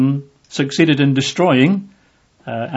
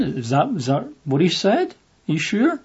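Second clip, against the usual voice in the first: sly serpent doubting; 4 semitones higher, 6 dB quieter than usual. Retold or imitated. Serpent sowing doubt in Eve.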